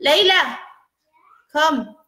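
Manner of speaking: high-pitched call for someone to come. Real, not acted. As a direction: summon your daughter from another room. Calling daughter into the room.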